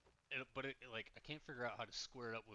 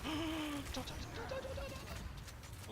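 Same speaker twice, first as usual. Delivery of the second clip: squealing don't, pitch climbing; high frantic pleading, stretched out; panicked high squeal; 3 dB louder, much slower than usual. Real, not acted. Panicked pleading during fight.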